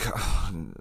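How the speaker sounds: soft, defeated sigh; real sigh of frustration; weary sigh. Real, not acted. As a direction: let out a quiet breath, giving up. Gives up on his point.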